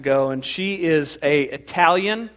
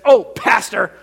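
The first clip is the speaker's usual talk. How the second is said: lightly put-on, louder parishioner voice; Teresa impression; 4 semitones higher, 6 dB louder than usual. Churchgoer answering her pastor about the message, loud impression. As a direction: call out as an eager churchgoer. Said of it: "that's exactly how she talks".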